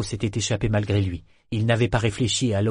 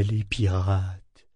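voix rêveuse; 4 semitones lower, much slower than usual. A narrator's reading. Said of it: "le ton semblait rêveur".

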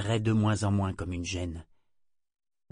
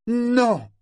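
loud, high outburst of no; exploding; 10 dB louder than usual. A narrator's reading. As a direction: bark a sudden refusal. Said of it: "explosa Ronica".

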